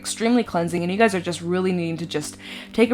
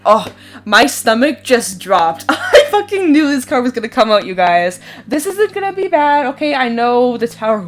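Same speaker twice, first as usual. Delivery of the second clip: loud, high nervous laughter at a scary card; nervous laughing; 4 semitones higher, 6 dB louder than usual. Real, not acted. Reader laughing nervously at the Tower card she drew. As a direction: laugh through the shock, louder.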